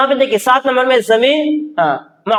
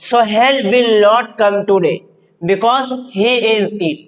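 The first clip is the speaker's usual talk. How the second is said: slow dictation; much slower than usual. Shown as a demonstration. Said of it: "देखो".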